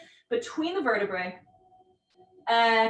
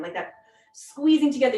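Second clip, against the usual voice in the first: normal talking voice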